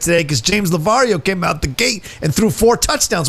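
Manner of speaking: hype announcer. Acted. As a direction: pump up the donations like a coach. Hyping donations like sportscaster.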